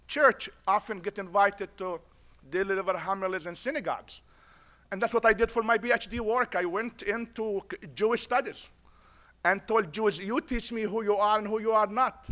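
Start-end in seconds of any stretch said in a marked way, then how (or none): none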